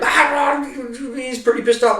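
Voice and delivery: mocking sergeant major. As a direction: squeak it out like a riled-up boss. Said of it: "and he's like".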